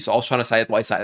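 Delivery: normal talking voice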